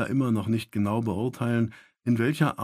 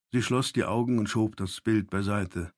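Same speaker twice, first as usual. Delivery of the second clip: ordinary delivery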